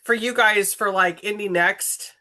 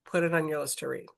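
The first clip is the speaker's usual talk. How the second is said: softer, quicker repeat of the urging; quiet fast aside; 3 semitones lower, 7 dB quieter, much faster than usual. Shown as a demonstration. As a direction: drop the volume and rush the repeat.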